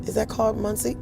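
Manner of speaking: ordinary talk, nothing marked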